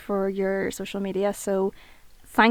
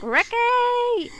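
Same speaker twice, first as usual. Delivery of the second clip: laughing, much higher mock shout of Ricky!; Bianca shout; 14 semitones higher than usual. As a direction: belt out Ricky! like the soap character. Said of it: "your woman from EastEnders".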